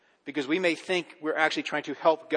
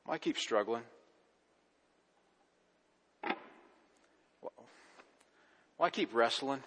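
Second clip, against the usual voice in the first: hushed sermon reflection; 3 semitones lower, 7 dB quieter than usual. Acted. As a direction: draw them in quietly, almost intimate. Quiet reflective sermon passage.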